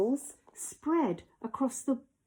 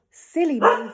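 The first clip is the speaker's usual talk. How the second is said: sighing; 5 semitones higher, 9 dB louder than usual. Acted. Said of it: "he sighed".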